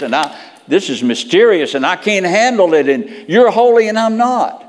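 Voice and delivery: builds from urgent plea to loud cry; overwhelmed plea. Retold or imitated. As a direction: Peter breaking under holiness, rising to a shout.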